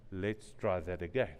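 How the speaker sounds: gentle reset. Retold or imitated. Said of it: "stop them and say".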